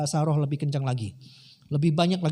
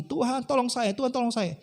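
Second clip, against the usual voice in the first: mock fervent prayer; 6 semitones higher than usual. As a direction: imitate someone praying harder for help. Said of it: "berdoa lebih kencang lagi". (menirukan doa memohon yang kencang)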